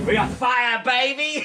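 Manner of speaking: high, loud whoop of triumph; excited shout. Real, not acted. Cook cheering as the fire gets going, excited shout.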